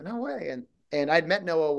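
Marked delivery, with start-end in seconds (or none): 0.0-0.5 higher and softer, a hushed gasp of disbelief; can't believe it, breathe it out high; stunned disbelief. Retold or imitated. Actor hearing he's still in the running, hushed disbelief. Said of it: "And I was like".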